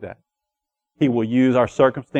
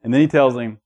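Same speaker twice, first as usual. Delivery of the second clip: ordinary talk, nothing marked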